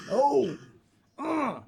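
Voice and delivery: high-pitched cringing "oh"; mock groans bracing for impact; acted wince. Watching him near the ground.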